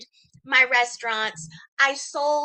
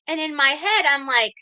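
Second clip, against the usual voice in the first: ordinary talk, nothing marked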